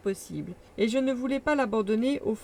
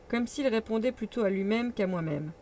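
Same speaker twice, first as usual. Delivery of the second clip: ordinary delivery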